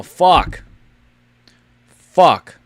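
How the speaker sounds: loud frustrated swearing; frustrated curse. Real, not acted. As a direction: blurt it out in annoyance. Wrong thumbnail frustration.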